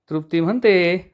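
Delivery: ordinary delivery, nothing marked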